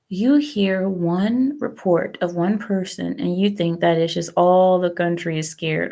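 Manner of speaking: ordinary delivery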